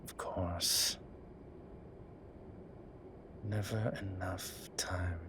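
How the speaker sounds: slow, soft whisper; wistful whisper. Acted. Lamenting lost time with her, slow whisper. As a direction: breathe it out with regret.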